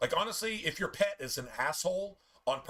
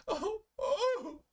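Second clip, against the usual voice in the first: pitiful dog whimper. Retold or imitated. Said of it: "convince everybody else that they're just".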